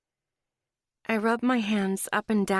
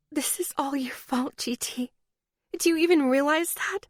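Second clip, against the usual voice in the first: tearful whisper; 5 semitones higher than usual. A narrator's reading. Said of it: "I whisper into the bed".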